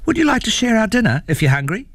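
put-on high, quick child's invitation; friendly child. A narrator's reading. Child offers troll dinner.

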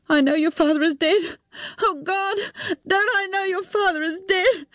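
high, crying through the words; breaking down in sobs over the dead man; sobbing woman. A narrator's reading. She sobs to Tim about his dead father. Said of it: "She burst into shuddering sobs".

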